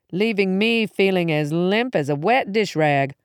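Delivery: plain reading voice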